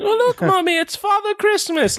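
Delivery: put-on very high-pitched little British girl voice. Little girl calling to Mommy on the sidewalk, high British accent. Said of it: "little girls in New York have British accents".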